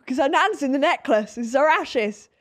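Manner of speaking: high, loud flustered explanation; flustered explaining. Retold or imitated. Explaining the necklace to the cabin woman, flustered and high. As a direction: rush to justify it, high and loud. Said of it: "And I'm like".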